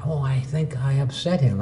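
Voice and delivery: soft thought to self; half-whispered worry; quiet inner thought. Retold or imitated. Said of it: "I said to myself".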